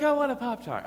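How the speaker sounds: high, loud imitation of a little girl; demanding toddler. Daughter demanding breakfast from dad, mock toddler demand. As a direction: mimic a toddler making demands.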